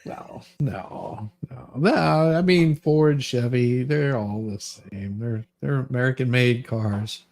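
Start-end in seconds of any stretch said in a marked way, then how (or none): none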